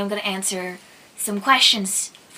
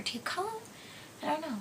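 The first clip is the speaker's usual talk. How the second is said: very soft, near-whispered puzzling; musing to oneself; 6 semitones higher, 16 dB quieter than usual. Real, not acted. Vlogger unsure what to call night daydreaming, musing to self. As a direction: trail off into a hushed, higher-pitched wondering. Wondering to herself about the word.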